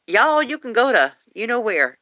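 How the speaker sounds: put-on high, sweet-talking Southern-accent telling-off. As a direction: tell them off cutely in a Southern accent. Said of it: "when people have an accent".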